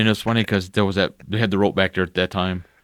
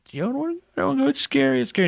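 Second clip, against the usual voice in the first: put-on high whiny child voice; a scared little girl begging; mock crying child. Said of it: "bawling her eyes out".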